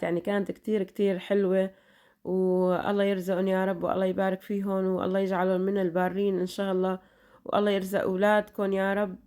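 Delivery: usual speaking voice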